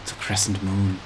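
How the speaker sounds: low, soft sultry delivery; quiet, seductive low murmur; sultry Kate Mulgrew. Real, not acted. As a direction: drop it low and hushed, sultry. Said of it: "I said that like sultry Kate Mulgrew".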